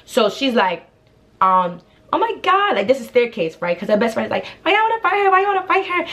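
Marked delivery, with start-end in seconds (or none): 2.1-2.8 very high mimicked outcry; mock dismay. Staircase after falling to the grass, high mock dismay. 4.6-6.0 high-pitched mimicked protest; mock the friend's pleading up high; mocking whiny imitation. Rival's best friend defending staircase, mocking high whine.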